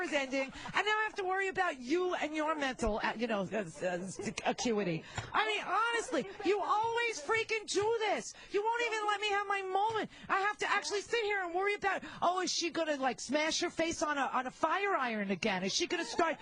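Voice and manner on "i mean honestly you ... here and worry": high, hammed-up exasperated outburst; mock exasperated rant. Mock rant at her friend.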